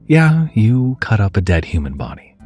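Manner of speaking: plain reading voice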